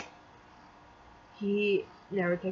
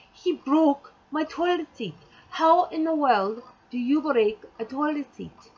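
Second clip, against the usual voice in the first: higher, slow, incredulous complaint; exasperated outburst; 6 semitones higher, 4 dB louder than usual. Real, not acted. Telling how he broke the toilet seat, exasperated. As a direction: punch out each word in disbelief.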